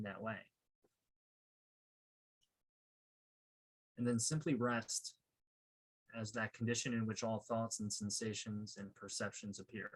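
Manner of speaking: calm, unhurried meditation guide with long pauses; guided meditation. Real, not acted. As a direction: guide them gently into stillness, leaving space between phrases.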